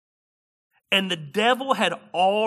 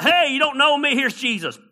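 loud, higher mock of a pushy evangelist; preacher mocking pushy soul-winners, loud and high; mocking pushiness; 9 semitones higher, 7 dB louder than usual. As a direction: ham up the clumsy pitch.

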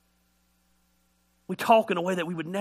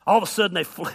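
normal talking voice

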